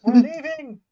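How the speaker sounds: high, very loud escape call; insistent in-character shout; urgent shout. Acted. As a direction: yell it like an order to flee. Acted escape, characters calling out.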